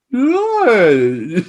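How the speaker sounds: high, exaggerated Australian "no"; hammed-up "no" with an Australian glide; demonstrated Aussie "no". Shown as a demonstration. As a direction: bend the vowel in "no" like a broad Aussie. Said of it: "the sound you're trying to conquer".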